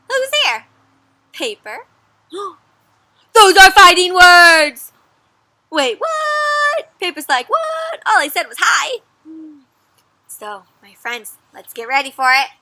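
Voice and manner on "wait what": usual speaking voice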